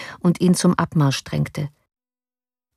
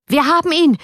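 shrieking much higher and louder; gleeful shout; 10 semitones higher, 4 dB louder than usual. A narrator's reading. Surgeon Teikela waving the paper, shouting in triumph. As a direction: crow it out in vile triumph. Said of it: "schrie er".

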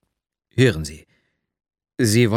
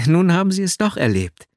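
a bright, laughing little tease, pitched higher; 7 semitones higher, 3 dB louder than usual. A narrator's reading. Girl teasing her new friend, laughing. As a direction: say it through a light laugh. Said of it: "sagte sie lachend".

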